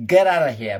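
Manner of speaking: loud fake American dismissal; mock American accent, loud and sharp. Shown as a demonstration. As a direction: bark it in a put-on American accent. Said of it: "with my fake American accent".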